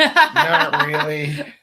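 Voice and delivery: high, very slow drawn-out admission; sheepish kid. Retold or imitated. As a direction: confess reluctantly, stretching it out. Kid admits not working.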